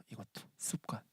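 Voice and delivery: preacher whispering a quiet aside; whispered aside. Real, not acted. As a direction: drop to a whisper for emphasis.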